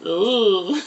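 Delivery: drawn-out "Ooh" vowel sound; sounding out words. Shown as a demonstration. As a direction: hold the "Ooh" like sounding a letter. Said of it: "while I'm sounding".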